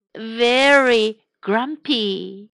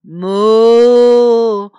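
loud drawn-out moo; cow moo; 2 semitones lower, 6 dB louder than usual. Acted. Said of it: "went the cow".

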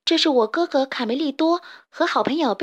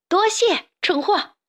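high, gleeful taunt; pert, teasing jab; mocking thanks; 8 semitones higher, 4 dB louder, slower than usual. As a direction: toss back a cheeky thank-you. Taunting the tricked villains.

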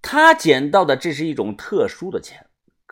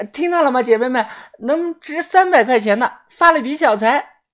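put-on high, excited girl's voice; gleeful, pitched-up announcing; very happy; 10 semitones higher, 4 dB louder than usual. A narrator's reading. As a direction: crow to girlfriends about a windfall. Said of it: "阿静很是开心".

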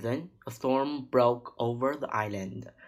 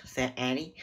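plain reading voice

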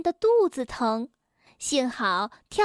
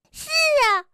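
whimpering; 12 semitones higher, 3 dB louder than usual. A narrator's reading. Bengbeng sadly admits.

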